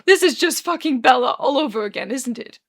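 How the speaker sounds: loud cry that tails off; tearful despair. Acted. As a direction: wail it, then sink on the question. Arthur breaks down in despair.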